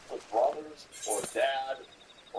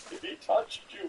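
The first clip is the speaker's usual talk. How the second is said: high, mocking jock impression; mock bro voice; 12 semitones higher than usual. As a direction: ham up a gym bro's warning. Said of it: "Every athletic goes".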